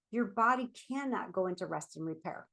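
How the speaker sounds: usual speaking voice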